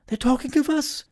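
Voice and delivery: very high, agonized woman's voice; horrified squeak at being discovered. A narrator's reading. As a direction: exclaim in high-pitched dread. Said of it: "exclaimed the agonized Missus Tibbs".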